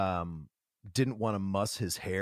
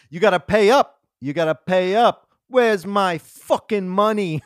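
mock Paulie demand; 7 semitones higher, 8 dB louder than usual. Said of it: "when he's like".

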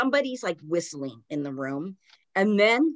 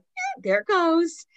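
mock snicker; 11 semitones higher, slower than usual. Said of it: "snickering, laughing".

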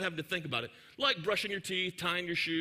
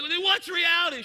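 put-on much higher, louder defiant child; angry kid shouting; 9 semitones higher, 7 dB louder than usual. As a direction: hurl the question back at mom. Child yells back at mother.

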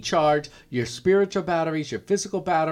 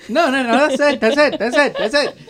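loud laughing no no no; 8 semitones higher, 8 dB louder than usual. Real, not acted. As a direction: cut her off, laughing. Cutting off friend, laughing.

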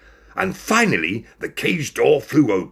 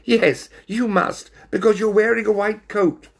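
high, loud cat character voice; high-pitched, pointed teasing; cheeky cat; 13 semitones higher, 4 dB louder than usual. A narrator's reading. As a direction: tease in a squeaky cat voice.

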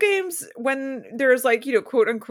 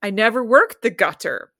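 normal talking voice

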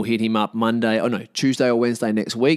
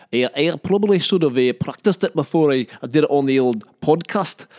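higher-pitched put-on Scottish; Scottish accent; 5 semitones higher, 3 dB louder than usual. Shown as a demonstration. Podcaster admitting he should have practised, in Scottish accent. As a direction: keep the comic Scottish going. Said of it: "This is my Scottish".

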